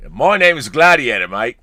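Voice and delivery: loud, hammed-up Australian accent; mock Australian accent. Host doing the Gladiator line on a viewer's request, hammed-up Australian accent. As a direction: put on a big Australian twang. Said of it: "using your fantastic Australian accent".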